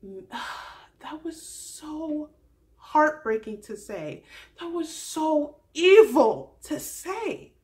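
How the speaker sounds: slow, loud, drawn-out disgust; outraged. Real, not acted. Vlogger reacting to the cruel breakup line, slow loud outrage. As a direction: stretch out the horror of it.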